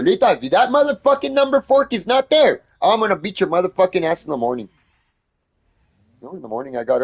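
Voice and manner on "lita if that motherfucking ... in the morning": high, loud threatening yell; replaying his own threat to the roommate; retold threat. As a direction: threaten her, pitched up and loud. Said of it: "I told her".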